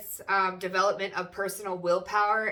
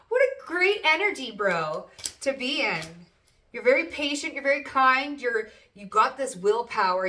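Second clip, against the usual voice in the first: higher, louder gushing praise; excited; 5 semitones higher, 6 dB louder than usual. Real, not acted. Tarot reader thrilled by the cards, excited. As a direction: rave about it.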